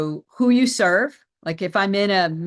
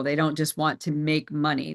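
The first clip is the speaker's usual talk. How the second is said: normal talking voice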